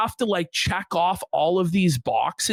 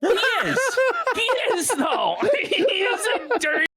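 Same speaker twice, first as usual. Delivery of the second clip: high laughing agreement; 12 semitones higher than usual. Real, not acted.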